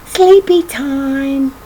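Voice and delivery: slow and hushed, lulling. Acted. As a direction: ease it out slowly like tucking a child in. Parents hush baby to sleep.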